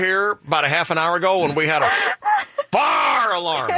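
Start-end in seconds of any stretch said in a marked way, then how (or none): 2.7-3.7 "fire" as a long, slow "farr"; mock mispronunciation. Host teasing Chris about the alarm, slow mock farr for fire. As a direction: stretch "fire" into a pirate-like farr. Joking with mock two-syllable pronunciation.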